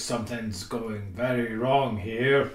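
slow, low Kiwi Boba Fett; a lightly put-on New Zealand drawl; New Zealand accent. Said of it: "is he saying that in a New Zealand accent".

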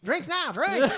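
high, loud, laughing sales pitch; hammed-up pushy barkeep; pushy bartender. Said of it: "pushiest bartender ever".

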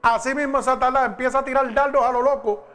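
loud, high, urgent sermon delivery; full-force preaching. Acted. Pastor warns the congregation about Satan's darts, loud preaching. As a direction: drive it home to the congregation.

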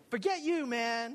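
loud, high, defiant brush-off; acting out youthful defiance; defiant dismissal. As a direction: throw it back at the doubter. Retells defiant answer to mentor.

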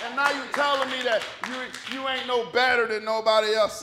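loud, incredulous telling-off; emphatic comic disbelief; mock scolding. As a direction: take him to task at volume. Scolds Elijah as if present.

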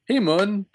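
Hamun said HAY-moon, pitched high; first vowel as "ay". Shown as a demonstration. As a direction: try Hamun with "hay" up front. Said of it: "I can't tell if it's Hamun or Ham".

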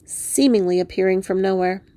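ordinary delivery

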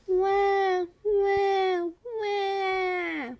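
loud, high, stretched-out imitation wail; baby crying. Baby ignoring grandmother's efforts, loud high mock crying. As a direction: wail like a baby who won't stop. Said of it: "the baby didn't stop crying".

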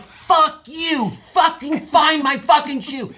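high, loud frantic yelling; furious high-pitched shout, acted out; retold scream. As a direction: scream it in a selfish panic, re-enacting. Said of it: "I just kept screaming at her".

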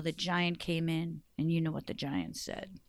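usual speaking voice

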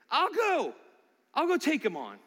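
put-on I'll go, like a kid who can't wait; eager volunteer. Preacher acting out David's eager offer to fight, excited.

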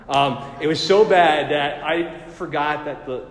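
ordinary delivery

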